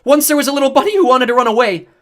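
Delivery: loud, high yell breaking midway; yell with voice cracking. A narrator's reading. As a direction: yell near tears, voice cracking. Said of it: "my voice cracked halfway through as I yelled".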